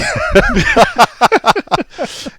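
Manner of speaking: a real high squeal of laughter. Real, not acted. Laughing at his own Beaver story, high squeal.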